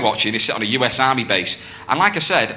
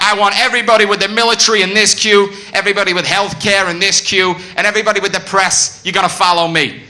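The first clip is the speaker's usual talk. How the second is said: a hint of raised sergeant voice; soldier giving orders; 5 semitones higher than usual. Soldier sorting the crowd into queues, lightly raised commanding voice. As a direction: call out the queues like a mock officer. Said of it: "this guy starts getting us into separate queues".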